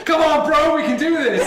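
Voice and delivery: high, loud pleading; desperate begging. Acted. Injured Edmund pleads with the players, high desperate begging. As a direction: beg them not to stop.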